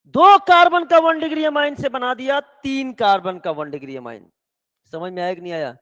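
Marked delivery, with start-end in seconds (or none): 0.1-4.3 loud emphatic teaching. Real, not acted. Teacher drives the carbon rule home to students, loud and emphatic.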